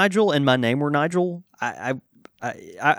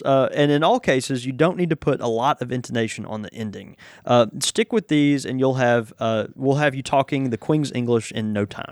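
ordinary talk

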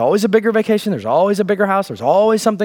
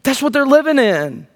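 ordinary talk, nothing marked

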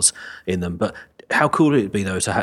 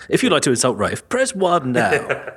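slow automated menu voice, laughing; robocall; 4 semitones higher than usual. Pretend. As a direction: read it like a phone menu.

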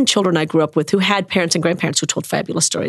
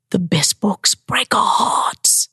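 gentle librarian; much slower than usual. Retold or imitated. The librarian comforting a crying child, hushed and slow. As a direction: soothe the grieving child gently. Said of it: "And she said".

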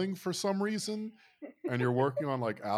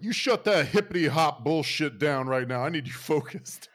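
loud, raised, blustering order; mock angry boss; 6 semitones higher, 10 dB louder than usual. Owner catching workers watching The Wire, loud mock scolding. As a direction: bellow it like an irate owner.

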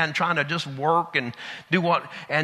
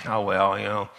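lower, softer talking yourself out of it; hesitant excuse; 10 semitones lower, 6 dB quieter than usual. Retold or imitated. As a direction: back away quietly from the idea. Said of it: "the more you think about it".

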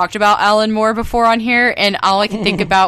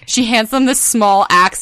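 usual speaking voice

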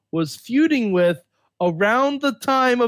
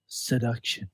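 seductive voice; 8 semitones lower, 7 dB quieter than usual. Pretend. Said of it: "that voice was for you, ladies".

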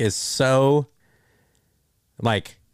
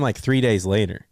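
usual speaking voice

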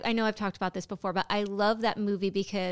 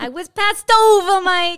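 higher and loud, put-on voice; mock Fredo wail; 7 semitones higher, 11 dB louder, slower than usual. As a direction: cry out like the passed-over brother. Acting Fredo to Michael.

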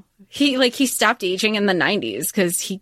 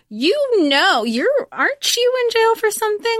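Billy Flynn sneering at the witness, much higher; mocking lawyer; 14 semitones higher than usual. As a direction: pitch it way up in a snide lawyer jab. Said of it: "So he's like".

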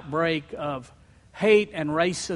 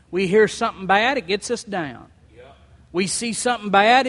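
normal talking voice

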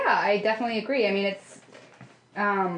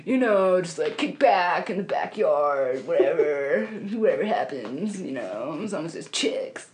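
lightly put-on lazy bro; bad guy voice. Mocking a guy who wants beer and chicks, lightly put-on bro voice. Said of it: "your guy voice sounds like a really bad".

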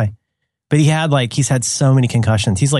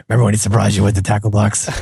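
ordinary delivery

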